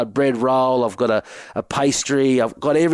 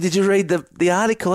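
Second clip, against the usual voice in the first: heated question; 7 semitones higher than usual. Retold or imitated. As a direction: pitch jumps well up, pressing the teammates. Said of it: "I said".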